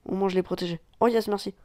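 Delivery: ordinary talk, nothing marked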